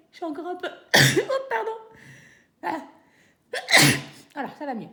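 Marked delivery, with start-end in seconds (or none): 0.1-1.4 éternuement soudain et fort; un vrai éternuement qui coupe la phrase; sneezing. Real, not acted. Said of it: "Pardon, je vais éternuer". 3.5-4.3 second gros éternuement; éternuer fort, pour de vrai; sneezing. Real, not acted. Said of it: "j'éternue toujours deux fois".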